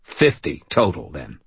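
low rumbling man. Acted. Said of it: "rumbled the new voice".

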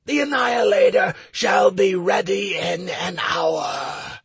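booming demon proclamation; demon lord. Acted. Demon answers hero.

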